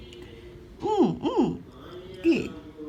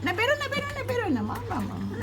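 high coaxing; 9 semitones higher, 3 dB quieter, much faster than usual. Real, not acted. Begging her pet not to get out, high coaxing.

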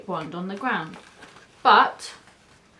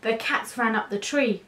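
ordinary delivery

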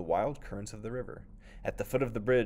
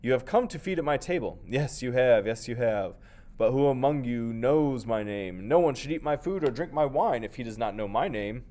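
proclaiming; 3 semitones higher, 7 dB louder than usual. Acted. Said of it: "the hippopotamus proclaimed as usual".

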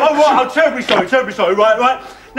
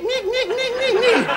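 very high, repeated squeaks; imitated squeaky noise; 10 semitones higher than usual. Gunman describing rubber toys to hostages, squeaking imitation. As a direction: squeak it like a little rubber thing. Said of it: "the ones that go nick".

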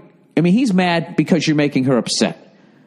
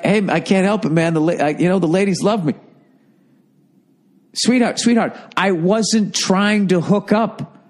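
smug, unbothered ladies' man; cocky arrogant; 5 semitones higher than usual. Pretend. Advising a guy to play it cocky with his sister, smug. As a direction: brush it off with swagger. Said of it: "go totally fucking arrogant".

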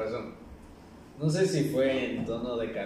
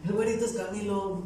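high-pitched quoted stranger; pitched-up retold exclamation; animated mock insistence; 9 semitones higher, 4 dB louder than usual. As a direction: imitate a fan insisting in a squabble. Retold argument over who he is.

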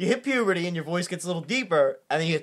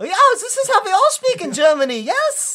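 high, hammed-up cartoon German accent; squeaky mock German scientist voice, much higher; cartoonish German accent; 13 semitones higher, 6 dB louder than usual. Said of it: "speak that way on television".

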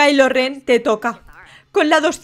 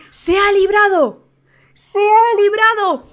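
slow, stretched, higher exclamation; amazed cheer; 4 semitones higher, 3 dB louder, slower than usual. Real, not acted. Host sees a player escape, amazed cheer. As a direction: draw it out in disbelieving triumph.

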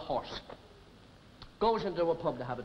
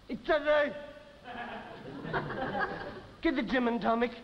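put-on slurred, sloppy drunk; wobbly tipsy man voice; town drunk; 4 semitones higher than usual. Drunk returns to bar.

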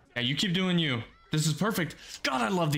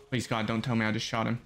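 ordinary delivery

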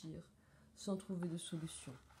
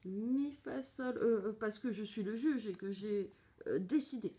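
put-on big voice; 3 semitones higher than usual. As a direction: stumble through a lightly put-on official voice. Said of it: "prend une grosse voix". (grosse voix feinte, hésitante)

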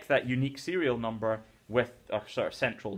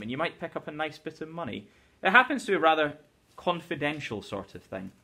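a teacher reading a classic novel aloud; story reading; 2 semitones higher, 4 dB louder than usual. Acted. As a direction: read the chapter to the class with life. Teacher reads Gatsby chapter aloud.

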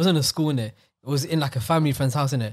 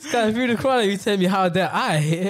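hammed-up disbelief, pitched up; mock indignant protest; 6 semitones higher than usual. Boy throwing the adults' scolding back, mock indignant.

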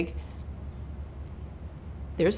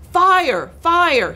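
loud, raised-pitch false alarm; alarm cry; 10 semitones higher, 7 dB louder than usual. A narrator's reading. Cat trying to clear the birds, crying out in alarm. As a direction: call it out urgently to scare them off. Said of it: "he cried".

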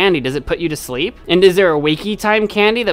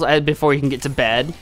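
normal talking voice

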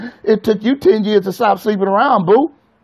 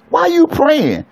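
much higher, pointed question; preacher's raised challenge; exclaimed question; 10 semitones higher than usual. Acted. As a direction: fire the question up high. Preacher echoes father's question.

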